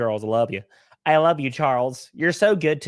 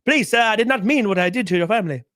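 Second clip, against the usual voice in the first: begging effeminate rider; 5 semitones higher than usual. Retold or imitated. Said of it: "and he's like".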